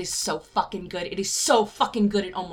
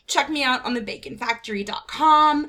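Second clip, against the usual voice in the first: ordinary talk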